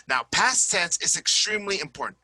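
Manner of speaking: ordinary delivery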